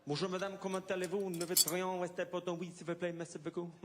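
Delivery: pleased-with-yourself tourist speaking French; groovy tourist French. Acted. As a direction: show off phrasebook French at check-in. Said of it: "thinking you're groovy".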